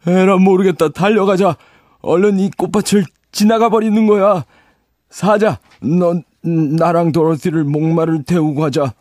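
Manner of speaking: high, eager rallying call; scarecrow rallying. Acted. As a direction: urge them on like a scarecrow leader. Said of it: "허수아비가 선동을 합니다".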